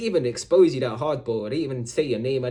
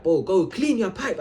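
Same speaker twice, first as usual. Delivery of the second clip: slow, raised, fed-up order; mock-angry retort; getting mad; 7 semitones higher, 3 dB louder than usual. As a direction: tell him off slowly, voice up. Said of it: "I just started getting bare mad".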